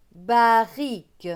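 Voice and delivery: "Barrique" said slowly with one short R; single R. Shown as a demonstration. Teacher correcting the double R in barrique, slowly. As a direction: stretch "Barrique", one R only. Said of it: "le R se prononce comme s'il n'y avait qu'un seul R".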